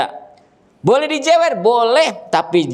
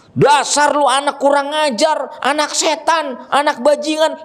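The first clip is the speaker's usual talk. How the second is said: mock angry parent; 8 semitones higher than usual. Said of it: "orang tua marah-marahnya sampai begitu".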